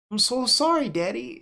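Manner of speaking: put-on high child voice, softening on daddy; a small kid apologizing; sorry little girl. Clara apologizes to her daddy for the pages, contrite child voice.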